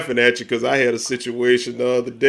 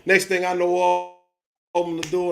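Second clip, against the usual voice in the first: ordinary delivery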